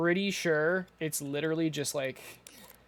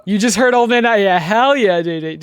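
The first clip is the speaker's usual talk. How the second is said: excited; 3 semitones higher, 12 dB louder than usual. Real, not acted. Excited reaction to a voice clip.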